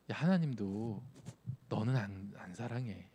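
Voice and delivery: tempter's hushed, discouraging aside; whispering Satan. Acted. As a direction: drop your voice to plant doubt, lightly acted. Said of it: "우리 귀에 속삭이는지 아세요?".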